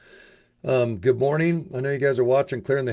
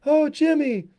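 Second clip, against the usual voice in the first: very high, strained creepy falsetto; a creepy puppet's high whine; 6 dB louder than usual. Retold or imitated. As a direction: squeeze it up into a leering falsetto. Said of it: "he had that creepy voice".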